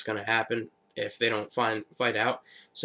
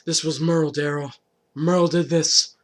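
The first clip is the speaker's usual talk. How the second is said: accusing; 3 semitones higher, 4 dB louder than usual. Retold or imitated. Glenn telling Daryl his brother did it, angrily. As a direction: hammer out who is to blame.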